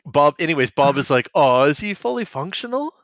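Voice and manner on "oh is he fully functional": high-pitched sympathetic question, lifting at the end. Acted. Bob asking Dot about Enzo, acted sympathetic voice. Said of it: "in a sympathetic voice".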